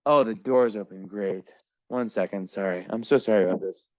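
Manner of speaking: ordinary delivery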